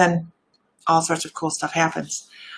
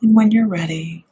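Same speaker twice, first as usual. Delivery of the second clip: meditative voice. Shown as a demonstration. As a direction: ease them gently back to the room. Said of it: "let me get out of my meditative voice".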